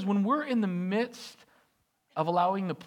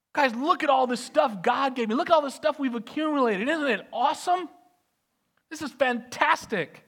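much higher, louder bragging; over-eager host showing his vault; giddy showing off; 6 semitones higher, 4 dB louder than usual. Acted. Hezekiah invites the envoys in, giddy and loud.

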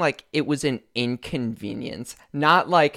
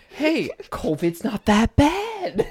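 high, drawn-out mock reassurance; mock breezy dismissal; 12 semitones higher, much slower than usual. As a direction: play it up slow and high-pitched. Mocking own breezy past claim.

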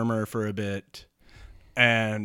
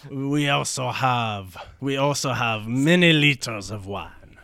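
put-on slow French-accented offer; hammed-up French accent, drawn out; 5 dB louder than usual. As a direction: boast about it as a French sailor. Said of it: "the French guy's like".

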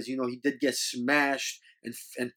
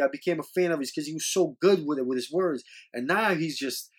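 usual speaking voice

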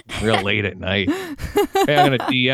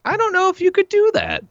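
very high, incredulous sitcom impression; high-pitched comic doubt; Jerry; 3 dB louder than usual. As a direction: push it way up high. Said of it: "pretty good impression of Jerry".

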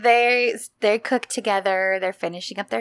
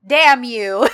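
louder mock-defiant snap; bold outburst; 3 semitones higher, 7 dB louder than usual. Erdem finally defying her, louder bold outburst. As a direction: blurt it with sudden mock courage. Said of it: "gets his little boost of boldness".

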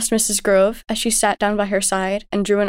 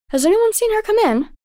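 higher, worried mother's question; anxious mother asking; 12 semitones higher than usual. A narrator's reading. Mother asks the family if anyone saw Amy, anxious. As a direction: ask the room, a little anxious.

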